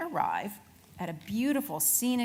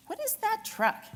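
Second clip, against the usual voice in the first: curious question; 13 semitones higher than usual. Acted. Roadrunner asks, curious.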